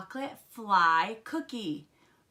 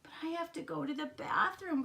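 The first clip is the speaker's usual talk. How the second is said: crying plea; 8 semitones higher than usual. A narrator's reading. Froggy pleading with Dad at the movies, high whine. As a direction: whine it high like a squirming kid. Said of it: "cried Froggy".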